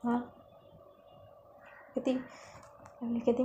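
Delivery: ordinary delivery, nothing marked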